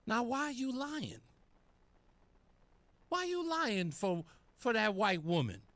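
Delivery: high, mocking why are you lying; incredulous mock question. Real, not acted. Taunting the anchor over the lie, high mocking incredulity. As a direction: needle her with a sneering high question.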